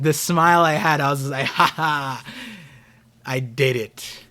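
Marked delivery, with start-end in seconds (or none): 1.5-4.2 slow gloating villain chuckle; mustache twirling villain. Retold or imitated. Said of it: "I sound so evil".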